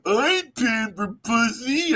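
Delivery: mock him like a stubborn old cheapskate; a hint of a sulky old man, slow; mocking Geo. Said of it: "just sitting there like".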